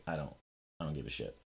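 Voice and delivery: low, quiet shrug of a voice; unimpressed indifference. Retold or imitated. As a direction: toss it away, unmoved. Retelling their indifferent reaction to reveal.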